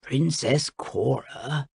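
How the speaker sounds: slow, soft, low, disappointed parent; sad voice. A narrator's reading. King reproaching his daughter, in a sad voice. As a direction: say the name slowly and sadly. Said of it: "in the sad voice that Princess Cora hated most".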